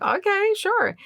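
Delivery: acting out an obliging reply; high-pitched agreeable okay. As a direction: go up high for a retold yes. Retells agreeing on the phone.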